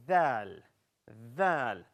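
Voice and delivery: dhal with a voiced th, tongue tip between the teeth; letter dhal. Shown as a demonstration. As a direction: model dhal for the class. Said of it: "and then say".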